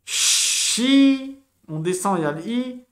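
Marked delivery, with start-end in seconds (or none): 0.1-1.4 Chi sung out high and loud as a held syllable; stretched syllable. Shown as a demonstration. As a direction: call out Chi high to show the sound. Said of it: "on lit en diagonale".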